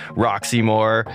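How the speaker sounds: ordinary talk, nothing marked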